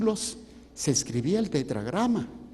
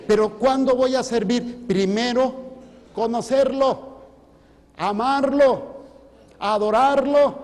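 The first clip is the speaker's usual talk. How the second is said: building higher and louder, slowing on each verb; preaching climax; 8 semitones higher, 6 dB louder than usual. Acted. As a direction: climb to a fervent peak. (predicación que crece, más aguda y fuerte)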